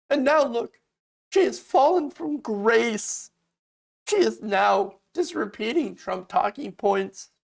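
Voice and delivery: snooty pearl-clutching mock voice; mock establishment. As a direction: play the shocked insider. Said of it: "they're like".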